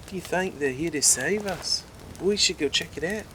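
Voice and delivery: hope it's rescue, eager to go; hopeful excitement. Acted. Richard hoping the light is rescue, excited.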